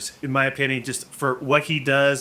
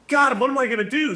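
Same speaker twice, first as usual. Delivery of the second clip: mock panic; 10 semitones higher, faster than usual. Retelling his panic.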